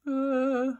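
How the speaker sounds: high, drawn-out pretend sob; mock bawling. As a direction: moan like a crying cartoon unicorn. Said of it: "'cause he's bawling so hard".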